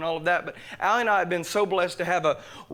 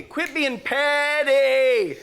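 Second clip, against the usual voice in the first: high, long-drawn preacher's yell; mock stern rebuke; 8 semitones higher, 5 dB louder, much slower than usual. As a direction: stretch it out into a loud scolding. Repeats the rebuke aimed at himself.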